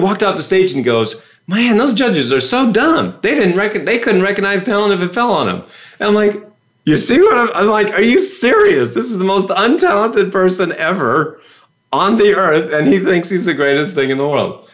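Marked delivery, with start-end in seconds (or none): 1.5-5.6 mock-indignant, pitched up high; indignant contestant. Buzzed-off contestant grumbles about the judges, mock-indignant. Retold rejected contestant's complaint. 6.9-14.7 incredulous. Retold or imitated. Viewer reacting to the most untalented contestant, incredulous.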